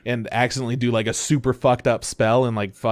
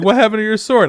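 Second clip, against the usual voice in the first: mock question; 9 semitones higher, 6 dB louder, faster than usual. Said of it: "they're like".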